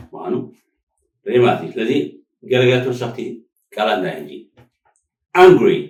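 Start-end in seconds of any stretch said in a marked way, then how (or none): 5.3-5.8 angry called out loud and high; teacher calls out a word to count; loud demo word. Shown as a demonstration. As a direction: announce the word to the class. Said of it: "two syllable".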